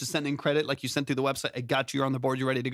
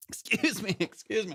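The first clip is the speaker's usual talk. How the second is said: strained after choking on water; choking on a drink; 6 semitones higher than usual. Real, not acted. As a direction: clear the throat between words. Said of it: "I'm choking on my own water".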